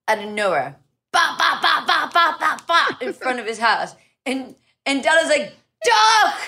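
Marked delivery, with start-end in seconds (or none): 1.1-3.0 high-pitched rapid bops; imitated gunshots. Guest retelling the shooting outside his house, mimicking gunshots. 5.8-6.4 high, loud mock yell; shouted warning. Guest acting out his warning as shots ring out, urgent shout. Retold warning during the shooting.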